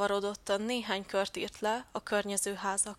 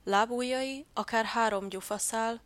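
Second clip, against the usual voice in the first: ordinary delivery, nothing marked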